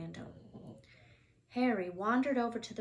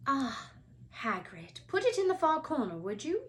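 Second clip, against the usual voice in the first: stern professor voice; 6 semitones higher, 4 dB louder than usual. Acted. McGonagall voiced in story.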